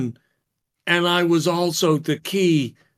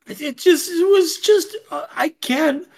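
much higher, breathless and flustered; 11 semitones higher than usual. Acted. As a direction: stammer like you're overwhelmed. Leary flustered, in character.

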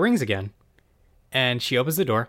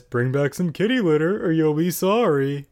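high, loud bossy cat voice; demanding pet making threats; sassy cat; 5 semitones higher, 4 dB louder than usual. Acted. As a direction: play the cat as a pushy little diva. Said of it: "He's a very sassy cat".

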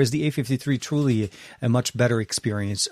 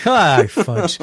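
mock minced oath; 6 semitones higher, 7 dB louder than usual. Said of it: "closest ... to legit swearing".

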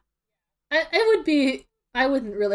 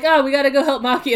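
urgent call; 5 semitones higher, 6 dB louder, faster than usual. Retold or imitated. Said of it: "so, like".

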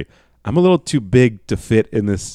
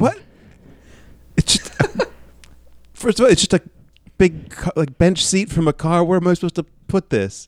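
incredulous; 7 semitones higher than usual. Real, not acted. Baffled by car seat gift.

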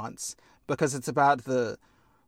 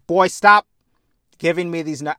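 loud scold; 3 semitones higher, 8 dB louder than usual. Retold or imitated. Voicing Hades scolding son.

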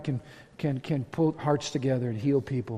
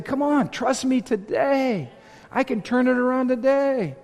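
high, drawn-out preacher's plea; urgent plea; 9 semitones higher, slower than usual. Acted. God urging a hearer to trust him, high impassioned plea. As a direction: call it out high and stretched, pleading.

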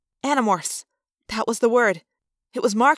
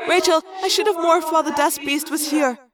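put-on higher girl's voice, rising in distress; young girl confessing fear; Cassie, scared; 6 semitones higher than usual. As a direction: admit the fear, voice lifting.

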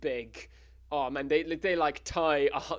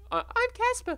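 limp Casper voice; 4 dB louder than usual. Acted. Casper's boring entrance.

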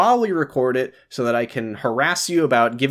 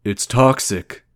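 slow, lower put-on voice; Kyle; 4 semitones lower, much slower than usual. Podcaster fulfilling a listener's request, slow character impression. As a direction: put on a deliberate, slowed character voice. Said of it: "this is a Kyle voice request".

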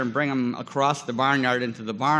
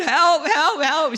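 high, louder shouted cries for help; yelling for help; 12 semitones higher, 5 dB louder, much slower than usual. Retold or imitated. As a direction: call out as if the kid is going under. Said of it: "I started yelling".

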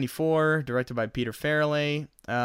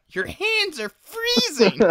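very high, slow silly whine; goofy complaint while choked; 4 dB louder, slower than usual. Retold or imitated. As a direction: whine about cold hands, strangled. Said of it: "And he's just like".

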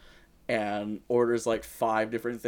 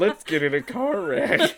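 mocking imitation; 6 semitones higher, 7 dB louder than usual. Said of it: "You did that like".

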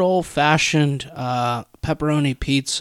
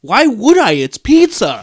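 indignant; 7 semitones higher, 7 dB louder, slower than usual. Real, not acted. Defending plain pizza, heated.

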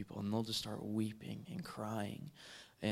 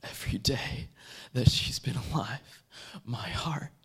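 loud, fervent preacher voice, phrase by phrase; impassioned preaching; 7 dB louder than usual. Acted. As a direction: preach it loudly, stretching key words and pausing between phrases. Preaches God's words at full force.

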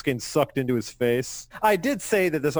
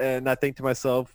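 usual speaking voice

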